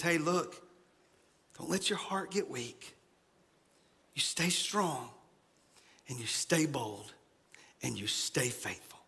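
soft and low, fading into a whisper; soft comfort. Retold or imitated. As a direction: hush them into courage. Paraphrase of Isaiah's comfort.

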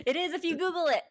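high, loud, squealed defense; amused protest. Real, not acted. To co-host doubting the streaming service exists, high-pitched protest.

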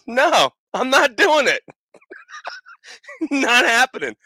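hammed-up no from the cage top; mock refusal.

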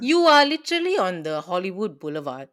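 disbelieving outburst; exasperated rant. Retold or imitated. Ranting at Americans' behaviour.

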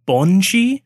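Bonji as BON-shee, rising at the end; tentative pronunciation. Shown as a demonstration. Host unsure of the Gaelic for banshee, Bonji with a questioning lift. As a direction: sound out Bonji slowly, like a question. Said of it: "so I think it's pronounced".

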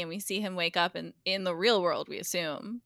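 ordinary delivery

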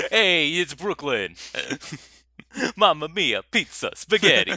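loud, slow hammed-up Italian accent; big jolly Italian chef shout; jolly Italian pizza chef. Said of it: "give the character some life".

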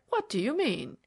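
high, swooping baffled question; astonished cry. A narrator's reading. Banker baffled by talk of his note, astonished cry. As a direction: demand it in bewilderment. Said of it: "cried Debrett".

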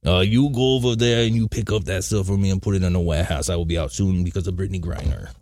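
lightly put-on French accent; mock foreign voice, slightly French; a little French accent. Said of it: "that was a little French".

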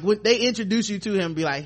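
usual speaking voice

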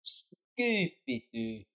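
slow Scottish Scooby-Doo, drawn out; Scottish accent. Acted. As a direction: stretch it in a Scottish lilt. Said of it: "but in a Scottish accent".

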